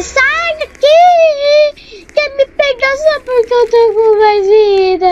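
high, loud panicked shouts; panicked yelling. Real, not acted. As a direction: yell at it to get away. Fleeing monster in game.

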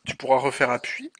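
usual speaking voice

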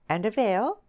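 high, sugary question; sweetly. A narrator's reading. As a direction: ask her oh so sweetly. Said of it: "she asked sweetly".

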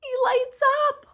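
high amazed wow. Pretend. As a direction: marvel at the toy. Alien toy lights up.

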